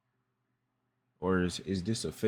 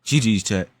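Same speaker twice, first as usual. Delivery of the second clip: loud; 8 dB louder, slower than usual. Real, not acted. Streamer gives up on video.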